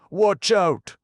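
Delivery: very high, loud warning cry; shrill loud warning; warning shout. A narrator's reading. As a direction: yell the alarm. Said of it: "warned Randy".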